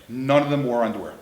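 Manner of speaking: usual speaking voice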